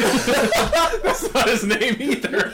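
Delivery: laughing. Real, not acted. Laughing, slow.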